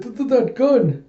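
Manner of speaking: high, stammering panic; imitating a terrified coward; shaking in fear. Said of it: "he's just shaking in fear".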